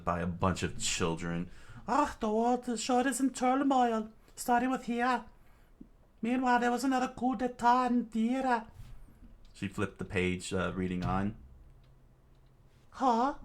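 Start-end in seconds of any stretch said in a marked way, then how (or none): 1.9-8.6 put-on high girlish voice reading the paper; Nami voice. 12.9-13.3 high puzzled huh; Nami voice. Acted.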